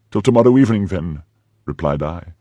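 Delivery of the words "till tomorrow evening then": higher, loud, defiant retort; enraged echo. A narrator's reading. Prisoner throws back her captor's farewell, loud and enraged. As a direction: hurl his words back. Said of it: "biting the carpet with rage".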